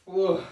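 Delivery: loud high whoa; a big silly whoa on cue; crazy sound. Pretend. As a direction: blurt out a wild whoa.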